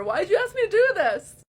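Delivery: high, loud voice cracking as tears come; real tearful wail at the audience; crying out. Real, not acted. Asking viewers why they requested this, crying and cracking. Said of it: "I'm actually starting to fucking cry here".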